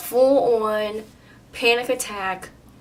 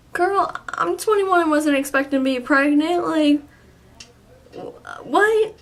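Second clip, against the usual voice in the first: higher, louder incredulous retort; acted disbelief; 7 semitones higher, 4 dB louder than usual. Young patient to nurse about an unexpected test, incredulous. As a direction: balk at the idea, pitch jumping up.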